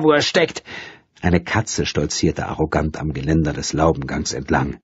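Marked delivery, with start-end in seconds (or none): 0.0-0.6 high, scornful ending. A narrator's reading. Woman scorning where the writer is. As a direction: toss it off with contempt. Repeated angrily.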